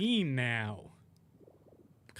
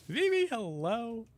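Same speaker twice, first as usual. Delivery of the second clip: high, drawn-out cheery greeting; upbeat demo; 10 semitones higher, slower than usual. Shown as a demonstration. Streamer showing off an upbeat voice, high and cheery. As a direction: put on a much higher, happy hello. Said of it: "my voice sounds happy and, like, upbeat".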